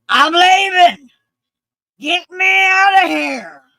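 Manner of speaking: high, loud furious yell, drawn out; screaming. Retold or imitated. Veteran demanding to be taken out, screaming. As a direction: holler it as the angry man did. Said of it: "a lot of screaming".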